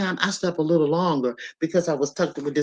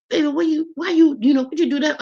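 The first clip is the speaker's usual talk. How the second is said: high-pitched tearful quote of herself; touched, higher voice, recalling being nearly in tears; retold near tears; 10 semitones higher than usual. Mother asking her babies about a surprise gift, retold nearly in tears. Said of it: "I was almost in tears".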